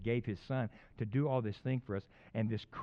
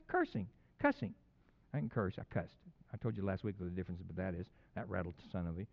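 ordinary talk

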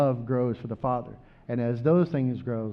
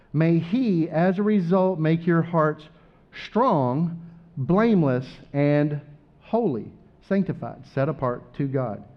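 raised, louder scripture reading with each word set apart; proclaimed blessing; 4 semitones higher, 3 dB louder than usual. Acted. Pastor pronounces the blessing over the congregation, raised and proclaiming. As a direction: lift the blessing over the room. Reads the blessing passage aloud.

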